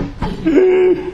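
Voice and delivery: sharp high gasp of panic; startled intake of breath; mock gasp. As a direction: gasp as if a test just landed. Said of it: "my heart was pounding".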